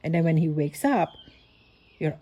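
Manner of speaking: ordinary talk, nothing marked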